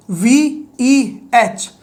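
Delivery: each letter वीईएच said separately and very slowly; spelled out letters. Shown as a demonstration. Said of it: "शुरू वाले letters उठा के एक short form बना दूंगा". (अक्षर अलग-अलग, बहुत धीरे)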